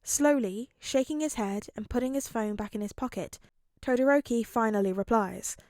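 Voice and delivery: plain reading voice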